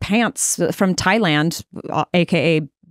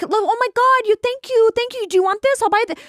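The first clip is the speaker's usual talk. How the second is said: imitating a gushing Chrissy; 11 semitones higher than usual. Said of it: "she was like".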